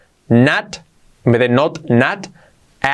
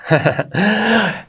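amused little laugh, higher pitched; 8 semitones higher than usual. Real, not acted. Teacher amused by own accent demo, laughing. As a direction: chuckle after hamming it up.

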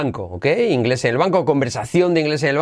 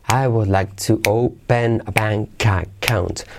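slow repeat model; 4 semitones lower, much slower than usual. Shown as a demonstration.

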